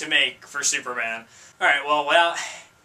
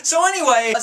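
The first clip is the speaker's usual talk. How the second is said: usual speaking voice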